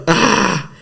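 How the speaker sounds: high, acted yell of alarm; short retold "ah!" shout; startled yell. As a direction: cry out as if fending off a knife. Acts cry while grabbing knife hand.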